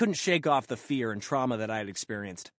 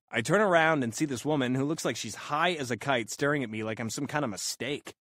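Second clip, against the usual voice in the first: usual speaking voice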